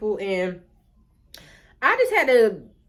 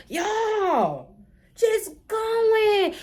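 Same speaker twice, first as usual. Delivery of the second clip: high, wailing cry of disbelief; exasperated outcry; 9 semitones higher, much slower than usual. Real, not acted. Storyteller reacting to the teacher's lie, wailing outcry.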